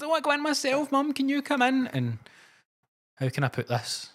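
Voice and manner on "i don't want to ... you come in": high whiny child's voice, mocking; crying little girl. Little girl pleading with her mum on the plane, high tearful whine. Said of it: "she was crying and that".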